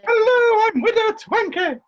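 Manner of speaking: loud, hammed-up dame greeting; booming panto dame hello. Panto dame introduces herself loudly.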